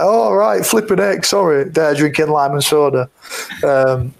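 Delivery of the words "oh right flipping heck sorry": higher, embarrassed mock fluster; retold sheepish surprise. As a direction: play it caught out and apologetic. Realising he was teased.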